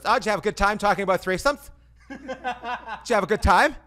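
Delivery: loud, high, fast sarcastic wife; mocking wife. Wife teasing him about the threesome talk, loud and mocking. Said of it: "she goes".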